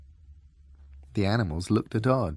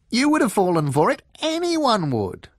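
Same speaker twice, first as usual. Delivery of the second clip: defensive fox; 13 semitones higher, 6 dB louder than usual. A narrator's reading. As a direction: protest that nobody could do better.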